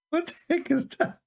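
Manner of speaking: asked high through laughter; incredulous, half-laughing question; mocking laughter at the news; laughing disbelief. Real, not acted. Laughing in disbelief mid-rant.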